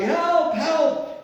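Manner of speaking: high-pitched mock cry for help; hammed-up call from a cliff edge; shouting for help. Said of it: "he's shouting".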